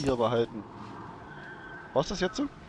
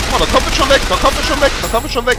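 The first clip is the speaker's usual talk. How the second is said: loud, higher, urgently pointing it out; excited call; 10 semitones higher, 11 dB louder, much faster than usual. Real, not acted. Gamer calling his companion, excitedly. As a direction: call out eagerly to Ellie.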